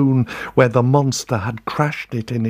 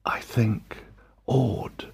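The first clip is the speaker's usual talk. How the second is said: ordinary delivery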